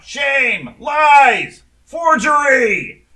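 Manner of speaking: high, full-force shouted accusations; crowd calling out. Acted. Game master voices the stunned crowd turning on her, shouted outcry. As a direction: yell each accusation out like an outraged heckler. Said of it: "starts calling out".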